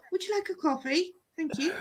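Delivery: soft, polite, high Edinburgh voice; lightly voiced posh Edinburgh offer; imitating a gentle Edinburgh accent. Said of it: "In Edinburgh".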